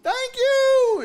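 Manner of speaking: high hooted thanks; loud aunt. Retold or imitated. Aunt takes the food, loud high thanks. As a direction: imitate an aunt trilling thanks at full volume. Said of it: "she was just loud".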